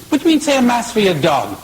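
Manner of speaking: a priest scandalized by the request, outraged and fast. Acted. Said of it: "Father's indignant, he's outraged".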